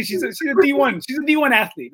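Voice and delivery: a touch of put-upon pride; mock indignant. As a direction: protest in mock offense. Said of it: "And I say".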